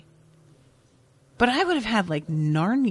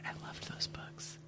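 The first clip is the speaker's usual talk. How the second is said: soft breathy whisper of fond memory; 18 dB quieter than usual. Real, not acted. To her co-host about Baba Yaga books, whispered. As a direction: breathe it out like a secret.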